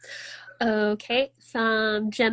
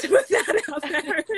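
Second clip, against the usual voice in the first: laughing; 6 semitones higher, 5 dB louder than usual. Real, not acted. Joking aside about the beer.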